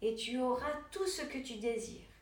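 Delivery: plain reading voice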